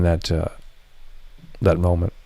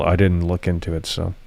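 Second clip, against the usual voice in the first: ordinary talk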